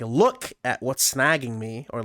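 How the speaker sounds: normal talking voice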